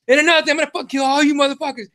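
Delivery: high, fast retold tirade; going off. Dragged back while still threatening, re-enacted going off. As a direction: rattle it off like a mock raging hothead. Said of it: "we're just going off".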